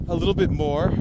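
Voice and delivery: normal talking voice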